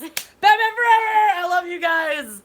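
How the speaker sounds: high, loud, drawn-out cheer; over-the-top celebratory yell; ecstatic shout. Real, not acted. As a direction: holler it with gushing delight. Celebrates correct guess.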